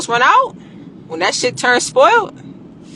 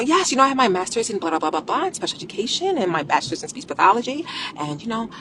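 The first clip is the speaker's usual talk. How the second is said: sweet, proper bank-customer politeness; code switching voice; 7 dB quieter, faster than usual. Shown as a demonstration. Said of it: "code switching voice".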